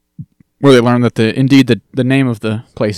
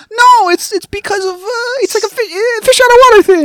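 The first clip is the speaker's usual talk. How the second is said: very high, squealed mock protest; Dee; 6 dB louder than usual. Co-host imitating Dee scrambling for an excuse, high squeal. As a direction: flounder for words in a squeaky put-on voice. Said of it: "And D's like".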